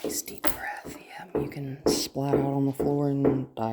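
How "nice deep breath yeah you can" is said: whispering. Real, not acted. Calming dog in whisper.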